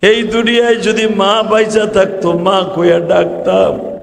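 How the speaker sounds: imitated crying in sung sermon style; tearful lament. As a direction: chant it as a weeping appeal. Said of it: "এইভাবে অবিসুরাতে কানতেন গো".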